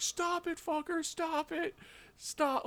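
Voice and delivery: put-on high, weak little kid cursing; weak child swearing. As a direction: whine it like a beaten ten-year-old. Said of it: "Like, really weakly, like a child when they swear".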